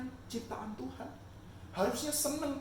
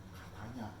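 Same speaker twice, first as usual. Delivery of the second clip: ordinary delivery, nothing marked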